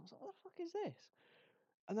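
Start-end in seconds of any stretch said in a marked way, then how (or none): 0.2-1.1 very high-pitched incredulous question; mock disbelief. Pupil baffled by textbook photo, high mock disbelief. Recalling reaction to textbook image.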